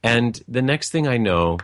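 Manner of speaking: ordinary talk, nothing marked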